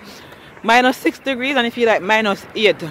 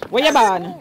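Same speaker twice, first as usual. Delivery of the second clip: Jamaican patois; 2 semitones lower than usual. Shown as a demonstration. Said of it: "tell me something in a patois".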